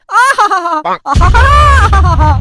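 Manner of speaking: drawn-out sigh of relief. Acted. Enjoying cool fan breeze.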